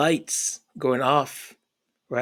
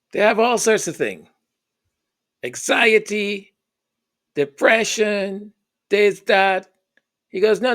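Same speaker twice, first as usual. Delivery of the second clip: lecturing immigrant dad; 6 semitones higher than usual. Retold or imitated. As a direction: lay down the law like a dismissive father. Dad lectures about depression.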